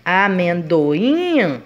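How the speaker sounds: "Amendoim" very slow, last syllable "im" held long; stress on final syllable. Shown as a demonstration. Teacher repeats to find the tonic syllable, "Amendoim" with lengthened "im". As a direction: sound out "Amendoim", swelling and falling on a long "im". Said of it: "o im é onde eu demoro mais".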